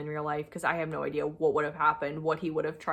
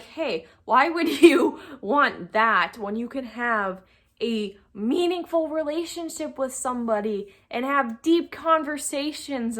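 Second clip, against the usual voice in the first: higher, slow eager sales pitch; salesperson pitch; 6 semitones higher, 4 dB louder, slower than usual. Retold or imitated. To a guy she's talking to, a higher mock sales pitch. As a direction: sell him on a real relationship. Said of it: "I basically became a salesperson".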